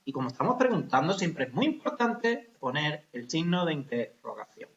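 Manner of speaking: ordinary talk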